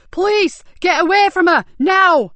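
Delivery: high, loud commanding yell; police shout. A narrator's reading. Officer shouting at the thugs from afar. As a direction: bellow across the car park. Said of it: "a loud shout echo".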